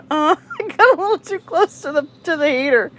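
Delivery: high, giggly imitation of his excuse; laughing retold excuse. Man owning up about the heater, retold through laughter. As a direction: laugh through the sheepish admission.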